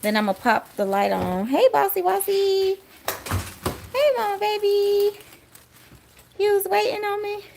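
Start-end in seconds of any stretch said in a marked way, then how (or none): 1.5-7.4 high, louder coo at the pet; sing-song greeting to a beloved pet; high cooing voice for the dog. Real, not acted. Greeting pet in baby talk.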